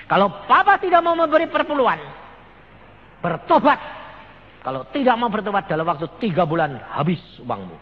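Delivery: loud, high preaching rebuke; retold stern warning. Son warns his father to repent, loud stern rebuke. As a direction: thunder the warning as a prophet would.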